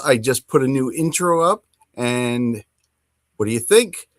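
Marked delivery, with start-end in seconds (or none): none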